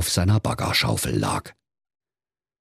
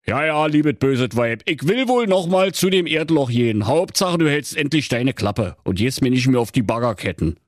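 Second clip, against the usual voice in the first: exasperated husband, pitched up; grudging higher-voiced surrender; henpecked husband; 9 semitones higher than usual. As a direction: give in to the nagging wife, grumbling.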